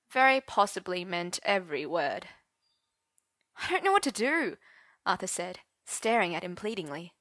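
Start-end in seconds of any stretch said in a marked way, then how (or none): 3.6-4.6 high, pleading voice. Acted. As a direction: beg for help. Pleading Arthur.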